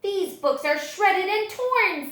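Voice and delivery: loud, slow, high complaint; high child's voice, upset. Acted. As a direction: wail it like a dismayed child. Child complains about damaged books.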